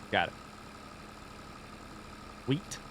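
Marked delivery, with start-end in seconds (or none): 2.5-2.8 "Wheat" with the final T emphasized; emphasis on T. Shown as a demonstration. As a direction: practise "Wheat", popping the T at the end. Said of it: "Emphasis on the T".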